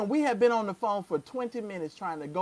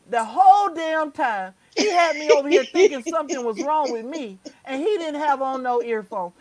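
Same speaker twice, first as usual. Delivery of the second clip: loud, high, indignant complaint; exasperated outburst; 4 semitones higher, 5 dB louder than usual. Real, not acted. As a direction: vent at being made to troubleshoot for nothing. Retells being blamed, worked up.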